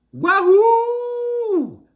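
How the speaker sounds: very high, loud whoop of joy; gleeful whoop. Acted. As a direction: whoop like you struck gold. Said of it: "cried the terrible".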